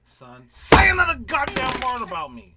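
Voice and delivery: high, very loud, drawn-out scolding; hammed-up threatening yell; imitated angry mom. Mom phoning to warn her son, mock angry yell. Mom's threatening phone call, acted.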